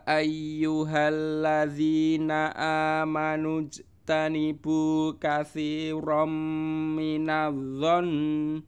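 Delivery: slow, chanted recitation with held vowels; chanted Quran reading. Acted. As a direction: recite the verse together with the class, slowly. Leads joint recitation.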